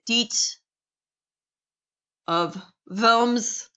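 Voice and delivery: "deet" of Worms with a German V sound, vorms; German pronunciation. Shown as a demonstration. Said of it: "So proper pronunciation with this".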